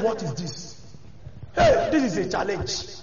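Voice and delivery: low, soft, startled murmur; Elisha dismayed. Retold or imitated. Preacher voicing Elisha finding the dead boy, low startled murmur. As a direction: take it in quietly, shaken by the challenge.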